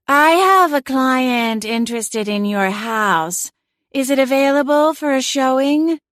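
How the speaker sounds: a cat playing a languid real estate agent; airy, sedated realtor voice. A narrator's reading. Celine fakes an airy realtor voice to the homeowner on speakerphone. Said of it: "I'll put on my real estate voice".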